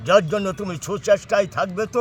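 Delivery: high, declaiming stage king; melodramatic folk theatre. Acted. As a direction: proclaim it like a jatra king. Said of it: "একটা যাত্রার dialogue দিচ্ছি".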